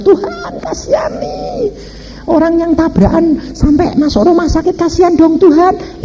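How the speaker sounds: very high imploring cry to God; retold high-pitched plea; pleading prayer. Voices his prayer for crash victims.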